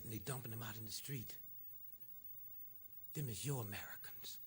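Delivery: hushed, intense whisper. Real, not acted. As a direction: whisper it soft and low. Soft point about abandoned veterans.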